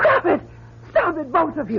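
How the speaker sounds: high, desperate plea; frantic cry. Acted. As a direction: scream at them to stop. Woman cries out at fighters.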